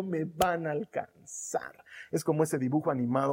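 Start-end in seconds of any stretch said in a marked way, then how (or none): 0.0-1.8 slow breathless whisper. Acted. Preacher whispers the psalm's promise to the congregation. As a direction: let the words fall to a whisper.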